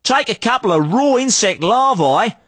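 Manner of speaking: hammed-up Aussie salesman twang; broad Australian accent. Street trader hawking junk in a broad Australian accent. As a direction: lay on the outback Australian accent thick. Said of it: "we only talk like that".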